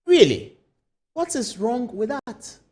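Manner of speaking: slow, challenging question; devil's advocate. Acted. Anchor playing devil's advocate to viewers, slow challenging tone. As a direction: push back at the host's doubts like a devil's advocate. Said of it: "I'm just playing the devil's advocate".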